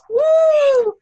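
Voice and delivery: high, loud cheering whoop; excited whoop. Real, not acted. Host cheering the top seller on the call, high excited whoop. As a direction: cheer her on like she's in the room.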